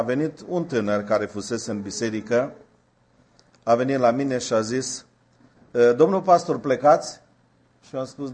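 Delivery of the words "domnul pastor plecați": higher, louder call to the pastor; the young man hails the departing preacher, eager. Retold or imitated. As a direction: call out after the leaving pastor.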